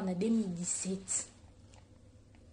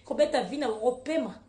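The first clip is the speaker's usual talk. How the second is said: usual speaking voice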